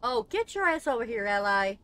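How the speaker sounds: loud summons to a teammate; calling over. Real, not acted. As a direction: holler for your partner to come.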